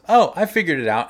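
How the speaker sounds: high, loud eager coworker line; mock coworker pleased with himself; coworker's eager quote. Coworker announcing his fix, loud and bright. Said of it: "And he goes".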